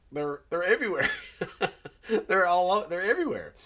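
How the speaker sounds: high, laughing retold answer; amused disbelief. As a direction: break into a laugh mid-answer. Retells his amused, baffled answer.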